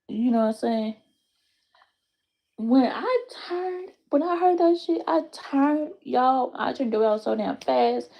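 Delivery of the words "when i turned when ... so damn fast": shocked. Retold or imitated. Shocked retelling of hearing cuss.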